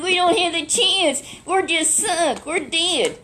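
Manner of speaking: high, loud, panicked cry of doom; a frightened servant sure they're finished; terrified servant. Retold or imitated. Servant crying out to Elisha that they're finished, high and panicked. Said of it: "this servant was terrified".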